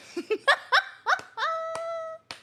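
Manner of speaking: high-pitched burst of laughter. Real, not acted. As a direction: crack up in a long, high cackle. Laughs at own swerving story.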